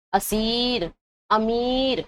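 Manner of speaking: اسیر، امیر with the ی held as a long "ee"; drawn-out vowel. Shown as a demonstration. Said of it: "کھینچ رہے ہیں کہ نہیں". (اسیر، امیر کو کھینچ کر)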